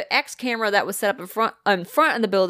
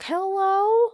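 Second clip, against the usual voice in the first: put-on much higher, lost old woman calling out; confused old woman; 12 semitones higher than usual. Retelling the ghost voice on the show, much higher lost old woman. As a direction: call into the empty house like a lost old lady.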